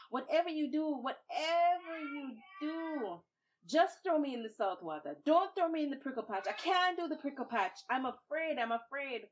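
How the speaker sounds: high, loud, crying plea; pleading fear. Acted. Babuki tricking captors, high crying plea. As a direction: beg them, feigning terror. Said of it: "Watch him now".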